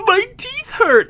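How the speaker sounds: put-on high whiny baby voice, slowed; high whiny mock voice. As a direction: whimper it like a child. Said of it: "I was like".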